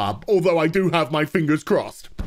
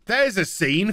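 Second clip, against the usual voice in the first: ordinary talk, nothing marked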